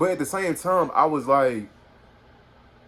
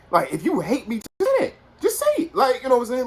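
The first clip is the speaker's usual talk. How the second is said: high, loud, fed-up pleading; calling out a fake friend, high and exasperated; exasperated plea; 6 dB louder than usual. Real, not acted. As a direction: demand honesty, voice jumping way up. Ranting at a two-faced friend.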